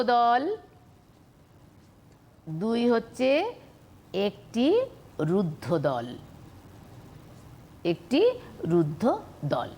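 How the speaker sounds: spaced-out words with long pauses; slow dictation. Shown as a demonstration. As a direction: dictate it piece by piece. Dictates the answer to pupils.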